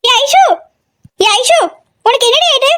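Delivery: high-pitched, panicked calling out to a hurt friend; alarmed concern. Acted. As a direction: cry out in worried alarm, pitch way up. Friend just got hit, alarmed. (பதற்றமான, உயர்ந்த குரலில் அக்கறை)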